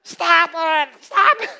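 very loud, very high yell; wife in labour yelling at husband, furious shout; shouted stop it. Retold or imitated. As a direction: yell at him to quit. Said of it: "Sue looks at me and goes".

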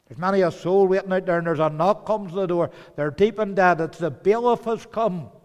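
fervent warning; urgent preaching. Real, not acted. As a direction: paint the dread with force. Dramatizing dread of the bailiff.

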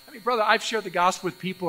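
ordinary talk

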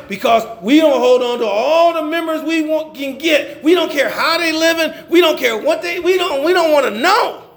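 high, indignant pulpit rant; fired-up preaching. Acted. Preacher mocking churches that keep every member, fired-up. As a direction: drive it upward as a rebuke in the churches' own words.

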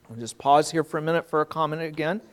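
normal talking voice

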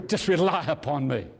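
ordinary talk

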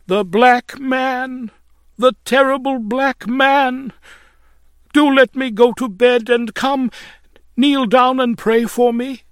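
high, loud, frantic pleading; wild excitement. A narrator's reading. Lodger begging her landlady for prayer, wild with excitement. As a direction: cry out high, then plead urgently. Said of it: "she was wild with excitement".

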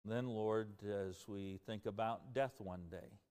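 earnest pastoral prayer. Acted. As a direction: pray over the congregation sincerely. Closing prayer.

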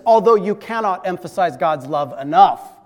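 ordinary delivery

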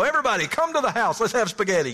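loud, high, fast hammed-up invitation; mock Italian host. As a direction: boom it out like a caricature Italian host.

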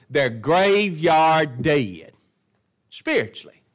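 slow heavy preaching; very slow emphatic. Acted. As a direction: drag out graveyard dead slowly.

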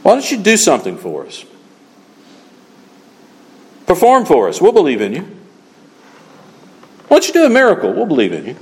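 a hint of taunting challenge, perform for us; mocking taunt. Preacher voicing the crowd taunting Christ, lightly mocking. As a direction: goad him like a jeering crowd. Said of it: "They tried that with Christ".

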